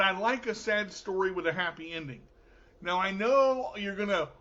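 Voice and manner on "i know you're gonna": normal talking voice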